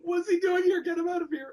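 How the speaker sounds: high, laughing mock outrage. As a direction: laugh through a mock protest. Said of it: "mock onlookers".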